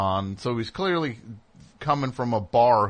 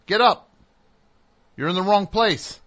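put-on loud, higher call to wake a drunk stranger; 8 semitones higher, 6 dB louder than usual. Speaker rousing the drunk on his couch, loud and higher. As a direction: raise the voice to rouse someone passed out.

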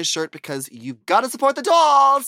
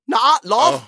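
put-on British accent; 9 semitones higher, 7 dB louder than usual. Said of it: "I have a great British accent".

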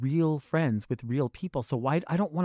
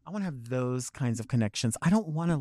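ordinary talk, nothing marked

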